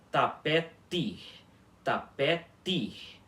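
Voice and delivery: tapete with a pure hard ti at the end; tapete, both T sounds crisp; correct hard T. Shown as a demonstration. Teacher repeating correct tapete for students, hard T. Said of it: "fica aí a pronúncia dessa palavra".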